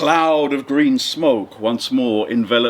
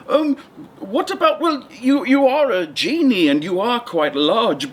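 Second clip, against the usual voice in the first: higher, nervous fisherman with a laugh; a frightened little man stalling for time, higher; 6 semitones higher than usual. Acted. Fisherman stalling the genie, nervous and higher-pitched.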